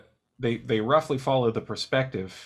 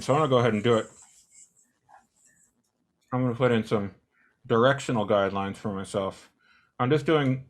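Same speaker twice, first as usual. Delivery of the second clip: talking around a pen in the mouth; pen in teeth; faster than usual. Real, not acted. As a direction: speak with a pen held in your teeth. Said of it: "sorry, I have a pen in my mouth".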